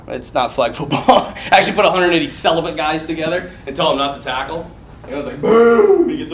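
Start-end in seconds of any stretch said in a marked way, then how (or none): 5.4-6.0 sudden loud boom for the impact; imitated collision boom. Acting out the football hit, explosive boom. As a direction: burst out a mock crash noise. Mimics colliding with another player.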